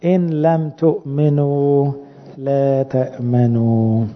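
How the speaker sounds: slow, word by word verse; slow recitation. Shown as a demonstration. Preacher recites the verse slowly for the congregation to learn. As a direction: recite it slowly so they memorize it. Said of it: "لازم تحفظوا الآية دي".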